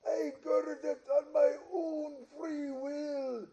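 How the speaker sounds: ordinary talk, nothing marked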